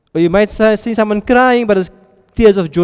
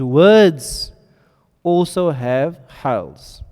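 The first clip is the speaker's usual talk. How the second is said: slow emphasis; 2 semitones lower, much slower than usual. Real, not acted. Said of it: "Why did I mention".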